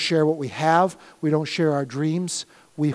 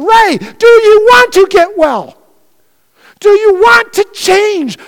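slow, high, loud calling question; voice of God; 15 dB louder than usual. Acted. God asking the pastor, slow and booming. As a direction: ask it slowly as a calling from above. God's question quoted in sermon.

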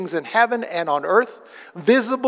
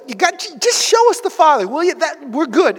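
pleading disciple; 8 semitones higher, 4 dB louder than usual. Retold or imitated. Philip voiced asking Jesus.

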